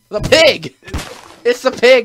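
loud, high cry of alarm; alarmed outcry. Real, not acted. Player spotting the pig that attacks him, alarmed outcry. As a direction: shout out a sudden warning. Sudden alarm at game enemy.

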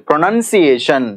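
ప్రొనౌన్సియేషన్ said syllable by syllable with NUN, not NOUN; ప్రొనౌన్సియేషన్ as a teacher's model, second syllable "nun"; careful pronunciation. Shown as a demonstration. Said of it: "ఎన్యుఎన్ రావాలి".

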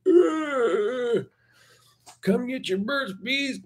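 high, strained mock pitchman; exhausted mascot. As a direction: squeal out a worn-out sales call. Imitating exhausted mascot after long flight.

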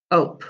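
"ope" said with no S in front; just the "ope" part, no S; beginning sound dropped. Shown as a demonstration. Teacher to pupils: "ope" without its first sound. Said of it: "drop the beginning sound".

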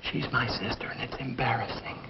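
very soft, confidential undertone; whispering. Acted. Boss explains his embarrassing sister to girlfriend, whispering. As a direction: hush it so others can't hear. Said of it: "What are you whispering about?".

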